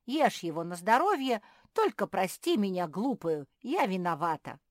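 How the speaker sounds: loud, emphatic pleading; loud, contrite outpouring; effusive apology. Acted. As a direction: beg to be forgiven, fervently. Hedgehog pleads for forgiveness.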